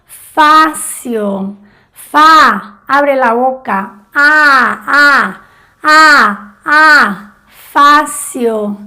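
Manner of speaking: facio with a wide open a, mouth open; open vowel demo. Shown as a demonstration. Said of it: "con sonido más abierto". (vocal abierta, boca bien abierta)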